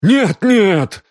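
high, loud cry of protest; panicked cry. A narrator's reading. Хэй fears exposure as his nerve fails, panicked. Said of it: "закричал Хэй".

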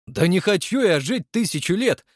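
high, emphatic protest; indignant. A narrator's reading. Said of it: "возмутился Крымов". (возмущённо, повысив голос)

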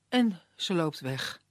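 plain reading voice

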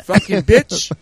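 high, put-on Italian accent; mock Italian customer. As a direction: bark the order like a rude diner.

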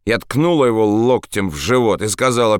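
usual speaking voice

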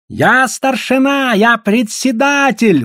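high, drawn-out protest of rank; exclaiming. A narrator's reading. Headman insisting on his rank, exclaiming. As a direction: proclaim your authority in a slow, high cry. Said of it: "восклицал Гао".

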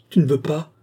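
a sudden defiant outburst; woman crying out at the marquis. A narrator's reading. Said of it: "s'écria-t-elle".

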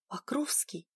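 sharp unvoiced whisper. A narrator's reading. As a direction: hiss his name under breath. Whispered hiss at him.

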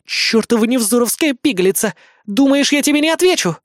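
put-on high, heated girlish threat; furious growl. A narrator's reading. Mariarti-san raging at Kri, angry growl. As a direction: threaten her in rising fury. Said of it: "прорычала Мариарти Сан".

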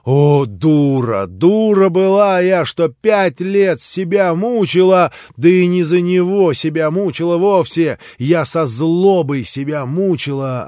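loud, slow self-reproach; loud bitter lament; anguished outburst. Acted. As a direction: rail at herself in despair.

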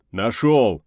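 big, loud triumphant call; trumpeting shout. Acted. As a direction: boom out the discovery. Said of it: "раздался трубный глаз Квадрата".

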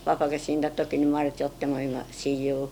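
ordinary delivery

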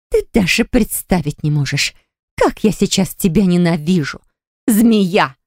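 ordinary delivery

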